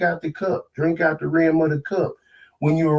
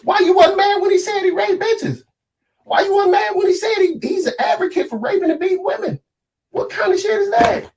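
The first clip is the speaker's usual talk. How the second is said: high, angry shouting questions; outraged shout; 11 semitones higher than usual. Real, not acted. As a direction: yell it in disbelief at the hypocrisy. Outraged rant.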